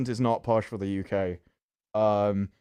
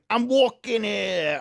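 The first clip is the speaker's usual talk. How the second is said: slow, higher New York cabbie voice; New York accent; 10 semitones higher, 3 dB louder, slower than usual. Retold or imitated. Quoting the movie line in a mock New York accent. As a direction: stretch it out in a New York drawl. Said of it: "I just like going".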